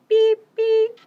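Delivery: high, loud toy horn beep; squeaky horn beep. Acted. Teacher reading the refrain, loud squeaky horn beep.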